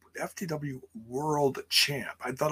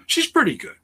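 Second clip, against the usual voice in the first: high, louder burst of approval; emphatic praise; 13 semitones higher, 5 dB louder than usual. Real, not acted. Host vouching for Sky Blue, high and emphatic. Praise, then quieter repeat.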